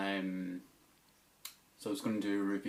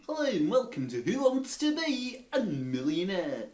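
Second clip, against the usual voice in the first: much higher, far-off game show host greeting; quiz show host; 11 semitones higher, 4 dB louder than usual. Retold or imitated. Old impressions video, a high quiz host welcome. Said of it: "do some impressions".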